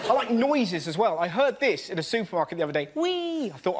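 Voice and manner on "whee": high mock whoop; gleeful whee. Old man skidding on a trolley, high gleeful whee. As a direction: let out a joyful whee. Old man's noise imitated.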